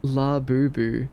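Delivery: slow syllable-by-syllable La Boo Boo, each boo a clear oo; careful pronunciation. Shown as a demonstration. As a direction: spell out La Boo Boo slowly with round oo sounds. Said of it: "It ends with an ew".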